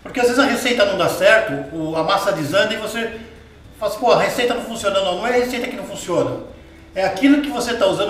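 usual speaking voice

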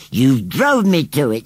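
slow, loud, high indignation; temper. A narrator's reading. Scarecrow blames children in a temper, angrily. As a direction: blame them in a huff. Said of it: "went pale green with temper".

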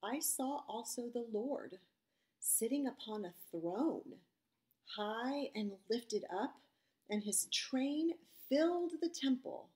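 solemn, measured Bible reading; scripture reading. Acted. Pastor reading Isaiah to the children, solemn scripture voice. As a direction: read the vision with weight.

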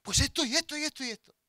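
put-on high, insistent child's retort; mock defiant listing; cheeky answering back. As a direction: pile up excuses in a high imitated child voice. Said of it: "encima se atrevió y le contestó".

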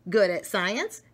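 ordinary delivery, nothing marked

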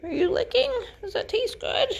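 high, sing-song pet talk. Real, not acted. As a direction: coo up high at the puppy. Cooing at the dog.

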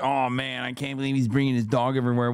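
fast, whiny mock zoomer complaint. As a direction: rattle it off like a sneering zoomer. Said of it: "Don't be like that".

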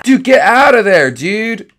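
high, loud cry at a friend; alarmed yell. Acted. Yelling at the friend who fell in, alarmed. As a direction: shout him out of there fast.

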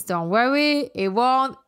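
ordinary talk, nothing marked

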